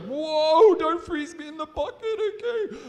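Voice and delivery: high, loud, hammed-up pleading; child volunteer begging not to be frozen, hammed-up panic; mock panic. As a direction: beg not to be frozen, playfully. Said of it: "You're like".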